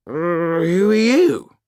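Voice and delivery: high, loud bleary demand; a drunk father not knowing his daughter; drunken confusion. A narrator's reading. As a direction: peer at her drunk and baffled. Drunk marquis confronts daughter.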